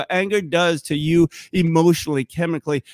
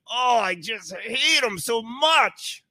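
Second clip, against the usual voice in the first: high, drawn-out seething without volume; hammed-up hatred; 5 semitones higher, slower than usual. Someone giving in to hatred, acted seething complaint. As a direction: squeeze it out slowly in mock loathing. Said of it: "hatred could come in".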